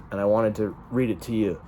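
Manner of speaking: ordinary talk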